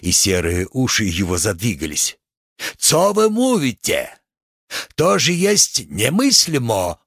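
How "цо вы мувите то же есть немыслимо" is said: very high-pitched outraged protest in character; indignant exclamation. A narrator's reading. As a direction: exclaim in scandalised disbelief, pitch leaping up. Рабацкий возмущён рассказом художника. (возмущённый высокий возглас)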